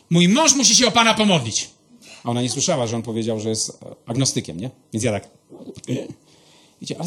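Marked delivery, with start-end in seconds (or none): 0.1-1.7 higher, slightly louder imitated wife; eager volunteering. Wife offers husband's prayer to an ailing stranger, eager imitation.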